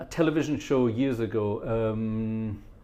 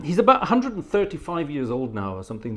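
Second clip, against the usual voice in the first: usual speaking voice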